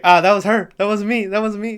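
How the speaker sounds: high, pleading mock denial; mock panicked excuse. Imitating a character blaming her, mock panic.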